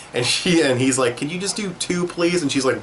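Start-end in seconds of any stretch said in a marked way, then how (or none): 1.2-2.4 pleading Aang. Retold or imitated. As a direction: wheedle for one more wish like a kid. Aang begging the spirit for more.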